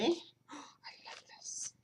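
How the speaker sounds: gasp, then a slow hushed whisper; delighted whisper. Real, not acted. Gasps and whispers over a gift.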